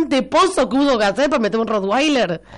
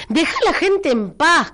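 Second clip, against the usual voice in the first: scolding; 7 semitones higher than usual. Real, not acted. Scolding a listener on air.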